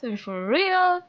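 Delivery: usual speaking voice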